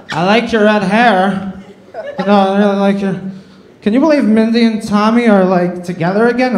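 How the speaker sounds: a hint of a tipsy girl rambling at him; drunk girl. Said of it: "she goes".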